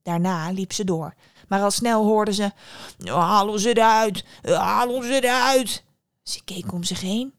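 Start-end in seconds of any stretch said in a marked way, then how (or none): 3.0-5.8 loud, slow, drawn-out bread voice; calling loaves. Acted. Bread in the oven calls the girl, slow loud calling. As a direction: bellow it slowly from inside the oven. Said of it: "ze zag dat het geluid uit een oven kwam".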